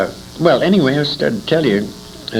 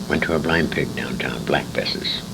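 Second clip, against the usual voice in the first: whispered, lower, as if sharing a secret; confiding whisper; 10 semitones lower, 5 dB quieter than usual. Real, not acted. Old man whispering to interviewer about the speakeasy. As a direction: lean in and whisper the name of the speakeasy.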